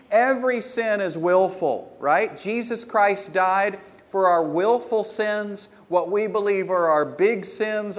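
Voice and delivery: high, loud preaching list. Acted. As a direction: preach it out with full force. Preaching at full force.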